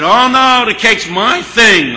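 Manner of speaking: high, drawn-out mock protest; loud protest. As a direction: object at full volume, stretching it out. [name] loudly protests about the cake.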